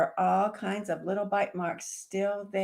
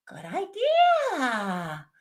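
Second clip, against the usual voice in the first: slow agreeing dragon; 7 semitones higher, 4 dB louder, much slower than usual. Acted.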